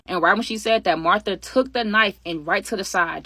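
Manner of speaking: ordinary talk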